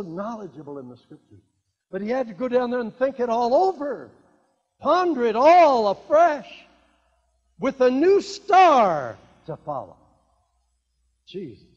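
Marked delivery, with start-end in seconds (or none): 1.9-10.0 rising, louder preaching; building sermon cadence. Real, not acted. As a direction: lift the congregation up, climbing.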